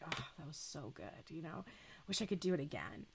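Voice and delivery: soft, hushed, sated murmur; contented sigh. Retold or imitated. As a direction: breathe it out like after a great meal. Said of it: "you just like".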